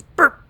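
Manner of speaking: sudden high loud burp noise; mock teleport burp. Imitating Slender's teleport, a loud high burp. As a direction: blurt out a cartoon burp sound. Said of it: "is when it just goes".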